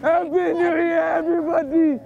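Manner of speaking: long, slow-stretched cheer; drawn-out New Year shout; celebratory yell. Real, not acted. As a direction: holler it out to everybody, holding the vowels.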